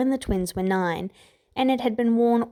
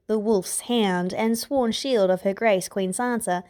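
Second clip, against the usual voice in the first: ordinary delivery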